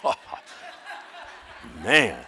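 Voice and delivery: ordinary talk, nothing marked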